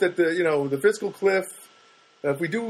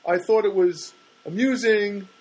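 imitating friend; 7 semitones higher than usual. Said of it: "And then he said to me".

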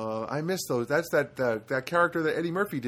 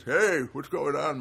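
slow, put-on old person's greeting; mock elderly voice; changed old voice; slower than usual. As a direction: drag it out like an old-timer. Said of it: "My voice changed".

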